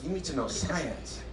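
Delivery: put-on dad retelling his daughter's answer, soft little kid voice; small kid voice. Hushed, matter-of-fact child.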